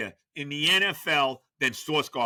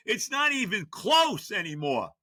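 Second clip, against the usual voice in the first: ordinary talk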